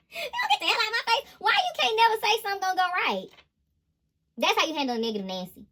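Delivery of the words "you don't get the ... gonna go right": high, exasperated mock scolding; mock exasperation. Reader scolds a negative Nancy in a high, fed-up voice.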